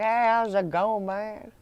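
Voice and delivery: put-on Deep South accent. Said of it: "like, the Deep South".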